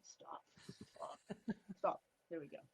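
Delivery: whispered stop, half laughing; whispered aside. Real, not acted. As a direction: whisper off to the side while fixing the camera. Camera messing up, aside.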